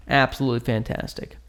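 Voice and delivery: ordinary talk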